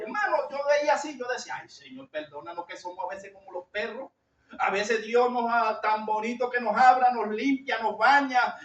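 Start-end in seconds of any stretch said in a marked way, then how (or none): 1.6-4.1 soft, lowered plea for forgiveness; retold prayer. Preacher recalls asking God's forgiveness, hushed prayer. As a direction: plead quietly, voice dropped.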